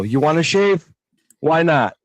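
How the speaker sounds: lightly voiced blunt barber; angry Greek barber. Greek barber sitting a customer down, a hint of gruff. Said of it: "sit you down in a chair and go".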